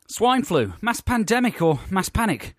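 high, punchy talk-radio intro; mock phone-in host. Host opening a spoof political phone-in, mock presenter voice. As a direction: sell it like a hard-hitting political phone-in presenter.

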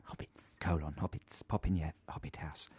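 soft, secretive whisper; whispered aside. Pretend. Host slipping in a hobbit gag, whispered. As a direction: drop to a furtive whisper.

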